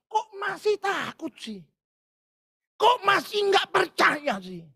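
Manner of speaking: high, slow preached rebuke; preaching rebuke. Acted. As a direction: drag out the reproach.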